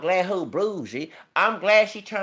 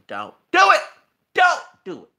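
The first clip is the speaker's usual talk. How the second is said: high, loud, urgent plea; emphatic retold warning; 9 semitones higher, 6 dB louder than usual. Warning a friend off the marriage, high and emphatic. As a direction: re-enact the warning, pitched up and punched. Re-enacting own warning to Chad.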